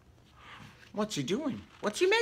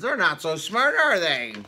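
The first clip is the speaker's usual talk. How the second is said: plain reading voice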